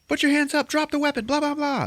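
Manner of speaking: high, loud mock police commands; cops shouting. Cops ordering the shooter, hammed-up high shouting. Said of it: "they're like".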